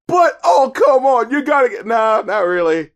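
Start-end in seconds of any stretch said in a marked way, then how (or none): 0.1-1.9 mock protest. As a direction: whine a fake argument. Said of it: "argue and be like".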